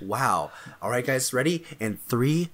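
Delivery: normal talking voice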